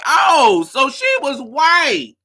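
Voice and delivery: loud, high, drawn-out exclamation; astonished realisation. Retold or imitated. Viewer retelling their discovery about her, astonished and loud. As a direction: belt out the discovery in amused amazement. Repeating the realisation amid laughter.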